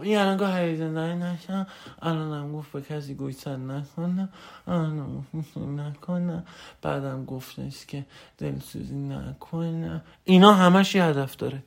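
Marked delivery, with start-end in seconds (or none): 0.0-10.0 very soft, slow put-on voice; imitating a hushed, hesitant pupil; meek listener recapping. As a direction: recite the rules back low and subdued. Voices listener glumly recapping his rules.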